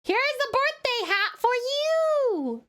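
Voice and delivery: high, slow, drawn-out flourish; celebratory presenting. Real, not acted. Streamer giving the birthday boy a hat, celebratory flourish.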